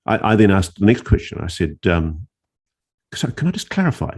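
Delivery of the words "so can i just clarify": much higher, quicker, incredulous; can hardly believe it; stunned disbelief. Retold or imitated. Stunned follow-up question.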